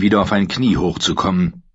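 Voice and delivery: ordinary delivery, nothing marked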